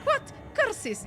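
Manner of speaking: a quick hint of Nadia's accent, curses jumping high; mock Nadia accent. Viewer previews Nadia's line, light mock accent. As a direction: fan tries Nadia's vampire accent, briefly mocking. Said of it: "she has this accent".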